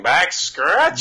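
put-on slow drawn-out old-timey call. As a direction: drag it out like an old-timey greeting. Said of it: "an old-timey voice".

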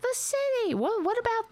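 high mocking imitation of a nagging woman, settling down; lightly voiced busy lady, high then fading; mimicking the lady. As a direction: parody her fussing. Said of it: "She was just like".

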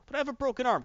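high-pitched mock protest; hammed-up high whine; whining excuse. Hero whines an excuse, retold.

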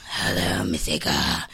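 deep mock boss greeting on the phone; Mona's thunder voice. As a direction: drop way down into a booming thunder voice. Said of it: "her voice is a little like thunder".

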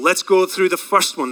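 ordinary talk, nothing marked

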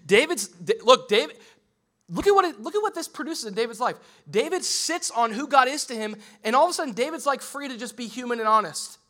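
loud, high, driving sermon voice; impassioned pulpit delivery; preaching at full force. Acted. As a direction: preach it with fire.

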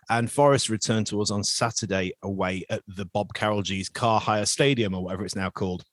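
usual speaking voice

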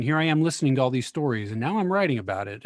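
ordinary talk, nothing marked

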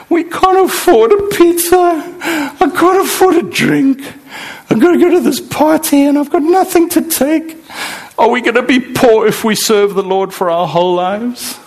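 high, tearful imitation of the wife; retold crying wife. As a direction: voice the wife's distress, a touch tearful. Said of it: "she was crying".

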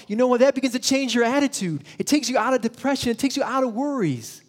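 high, loud, fast preaching push; fervent preaching. Real, not acted. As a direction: lift the congregation with energy. Preacher lifting his voice.

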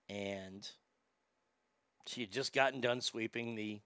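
ordinary talk, nothing marked

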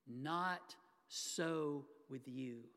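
slow and weighty, each word apart; slow emphatic quote. Acted. Preacher stressing Jesus's words to the congregation, slow and weighty. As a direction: let each word land slowly. Quoting Jesus, then dwells on it.

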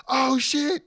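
high, loud burst of acted surprise; mock startled realisation. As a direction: imitate someone suddenly caught out. Acting Truth's sudden realisation.

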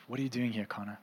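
soft, low voice of God; gentle near-whisper. Retold or imitated. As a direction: drop it right down, almost a whisper. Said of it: "And then he whispers".

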